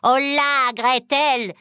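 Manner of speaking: loud, high summoning cry; impatient witch's shout. A narrator's reading. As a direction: yell for the servant girl. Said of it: "cria-t-elle à la fillette en pleurs".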